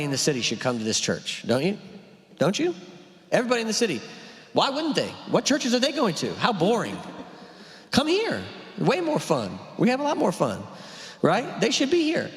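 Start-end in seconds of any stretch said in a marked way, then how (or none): 2.4-2.7 squeaky high, hammed-up eager question; mock eager. 3.3-12.3 high, hammed-up church salesman; mock boosting.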